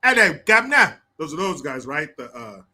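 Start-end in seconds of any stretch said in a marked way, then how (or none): 0.0-1.0 loud, high-pitched mock British greeting; mock British hooligan. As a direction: call it out in hammy British. Mimicking British soccer hooligan stereotypes.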